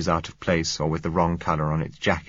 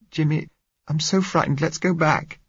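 put-on higher, pleading scared girl's voice; frightened young girl; 10 semitones higher than usual. A narrator's reading. Blind girl begging Jimmy not to leave, frightened. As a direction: plead with him, fear rising.

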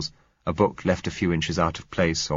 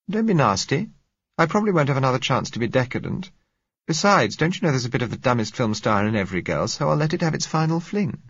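put-on higher, quick playful young woman; young woman teasing; 7 semitones higher than usual. A narrator's reading. Gisella teasing the narrator about her room, playful. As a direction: tease him lightly, brightly.

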